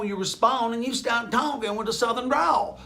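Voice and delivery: Southern drawl. Shown as a demonstration. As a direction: shout it in a mock Southern drawl. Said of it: "Sorry if you're from the South".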